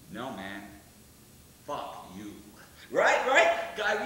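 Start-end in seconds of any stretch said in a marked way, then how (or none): 0.1-2.3 much lower, quiet, slow menace; menacing low comeback. Retold or imitated. Frankie answers the hippie with a low, quiet menace. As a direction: say it low and dead calm with a knife out.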